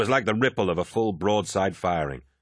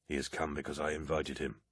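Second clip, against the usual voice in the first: low solemn voice; 4 semitones lower, 11 dB quieter than usual. Acted. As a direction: lower and softer, solemn. Aximand answering ritual question.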